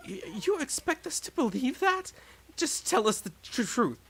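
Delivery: lightly voiced distrustful classmate; suspicious accusation.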